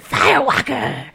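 playful rasped announcement; raspy band-name voice. Pretend. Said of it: "say it in that voice".

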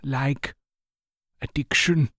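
slow, drawn-out list. Acted.

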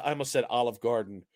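normal talking voice

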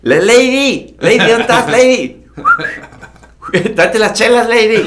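high, loud buddy-style shout; calling out to Lady. Retold or imitated. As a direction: hollers for her like a drinking pal. Jokingly calls out to [name].

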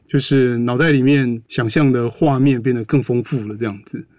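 ordinary talk, nothing marked

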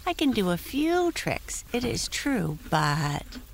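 high modest pony voice; humble little pony; talking pony. Acted. Prancer voice.

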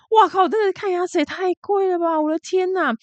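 high, squealing disbelief at the price; shocked exclamation. Real, not acted. Vlogger reacting to US dental costs, shocked. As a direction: gasp it out like the bill just landed.

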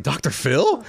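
shoots up high in astonished question; can't believe the name he just heard; amazed disbelief. Retold or imitated. To his friend offering the Doctor Phil job, astonished and high.

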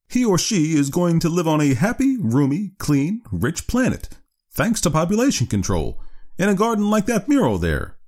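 high, lilting doctor's speech; visionary doctor. Acted. Doctor promising the child a happy planet, high and idealistic.

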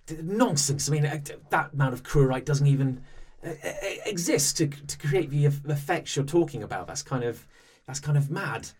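high, sputtering indignant protest; flustered denial. Acted. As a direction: splutter like a cornered official. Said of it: "he's like".

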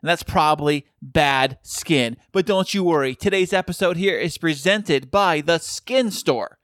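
upbeat, polished podcast ad read; sponsor ad read. Acted. Podcast host reading a skincare sponsor ad to listeners, upbeat ad read. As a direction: sell it like a cheerful radio spot.

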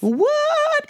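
high squeal of disbelief; hammed-up what; mock shock. Mock amazement at Patti's singing.

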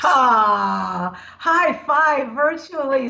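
bright, high cheer of congratulations; high-pitched slowed celebratory cheer; excited cheer. Real, not acted. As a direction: celebrate her news. Celebrating friend's news.

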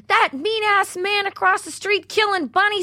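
high imitating a little kid; squeaky voice of the kids' little friends; high, tattling kid voice. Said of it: "The kids' little friends was like".

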